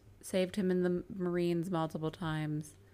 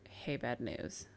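whisper; 6 semitones lower, 8 dB quieter, slower than usual. Retold or imitated. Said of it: "comes and sees Jack and is like".

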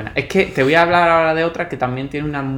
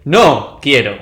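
pointedly raised voice; loud refusal; 7 dB louder, much slower than usual. Real, not acted. Co-host told to speak up refuses, loudly. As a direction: push it out loud in mock defiance. Answers request to speak louder.

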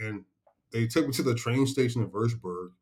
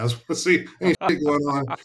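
quoting the sergeant through a laugh, pitched up; laughing retelling; 5 semitones higher, 4 dB louder, faster than usual. Retold or imitated. Veteran retelling his NCO's joke, laughing. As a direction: laugh out the punchline of the prank.

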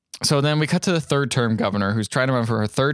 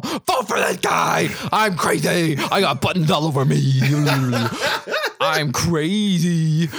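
manic hammed-up shouting, higher pitched; Chris Farley; 3 semitones higher, slower than usual. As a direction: bellow like an overexcited goofball, stretching the words. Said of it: "pretty good, uh, Chris Farley impression".

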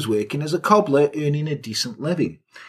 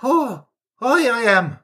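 high-pitched mock Irish accent; Irish lover; 7 semitones higher than usual. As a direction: put on a hammed-up Irish voice. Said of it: "'cause I'm Irish".

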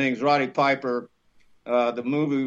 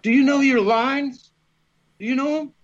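pointed question; 9 semitones higher, 4 dB louder than usual. Retold or imitated. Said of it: "I'm like".

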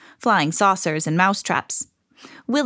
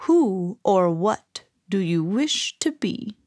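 slow, mystical lizard; drawn-out magical question; slower than usual. A narrator's reading. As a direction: intone each word slowly and grandly. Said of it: "said the lizard".

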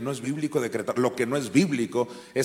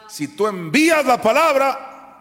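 high, loud, slower shout; full-force preaching; 12 semitones higher, 9 dB louder than usual. Acted. Preacher urging believers to send the word, shouting. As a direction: proclaim it like a battle cry. Preacher repeating his key line.

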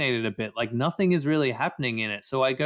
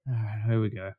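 ordinary delivery

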